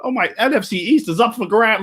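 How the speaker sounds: high, mocking excited fan voice; mock hype fan. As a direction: parody the hyped-up rivals. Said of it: "that would have sparked the whole fucking, like".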